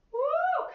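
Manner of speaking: excited woo. Real, not acted. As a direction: cheer them on with a whoop. Instructor cheering the class.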